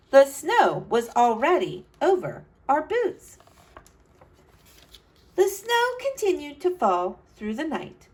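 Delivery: put-on bright, lively read-aloud to kids; storybook narration. A narrator's reading. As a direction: tell it like a storyteller for children. Reads picture book aloud.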